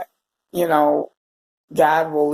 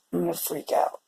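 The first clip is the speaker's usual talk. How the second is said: holding back tears; 2 semitones higher, 8 dB quieter than usual. Real, not acted. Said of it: "I know I'm gonna cry".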